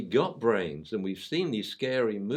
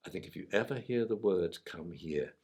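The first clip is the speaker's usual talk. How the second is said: wise sensei; 6 semitones lower, 8 dB quieter than usual. Retold or imitated. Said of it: "Mister Akita, who he calls Sensei".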